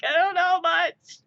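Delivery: high, loud mock wail; mock panic. Speaker fleeing own bad French, mock-panicked wail. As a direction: wail it in pretend despair, then flee.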